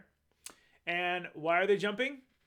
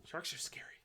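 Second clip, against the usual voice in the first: whispered, much softer; hushed aside; 11 dB quieter than usual. Acted. Reader confides to young listeners, whispering.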